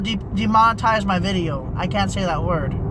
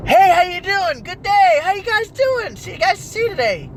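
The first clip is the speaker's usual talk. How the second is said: friendly locals; 10 semitones higher than usual. Acted. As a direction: greet passersby with exaggerated friendliness. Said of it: "and everybody was friendly".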